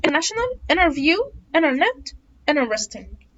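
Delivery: "International" said as "innernational"; silent t. Shown as a demonstration. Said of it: "خليها silent".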